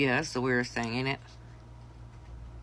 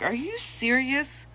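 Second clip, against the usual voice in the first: much higher, slow disbelief; incredulous husband; 9 semitones higher, slower than usual. Retold or imitated. Husband hearing her secret, slow high disbelief. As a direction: stretch it out, high and amazed.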